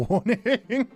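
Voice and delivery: ordinary talk, nothing marked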